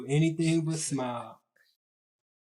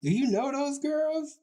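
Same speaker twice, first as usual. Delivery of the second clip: friend's question in a much higher voice; high-pitched questioning; 11 semitones higher than usual. Retold or imitated. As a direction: pitch it way up, imitating a curious friend.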